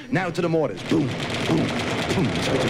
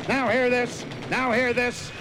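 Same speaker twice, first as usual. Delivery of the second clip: high, announcing PA voice; navy megaphone announcement; ship loudspeaker; 9 semitones higher than usual. Acted. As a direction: call it over the ship's speakers.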